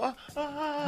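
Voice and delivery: high, soft head-back "ha ha"; put-on gorgeous-woman laugh, light and soft; fine woman's laugh. Host mimicking fine women, high mock laugh. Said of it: "Yeah, the head mob".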